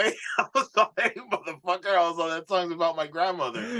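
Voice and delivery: high, laughing mock protest, pitched well up. To the manager about his grandmother, high laughing protest. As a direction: squeak out an offended protest through laughter.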